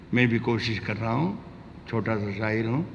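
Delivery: ordinary delivery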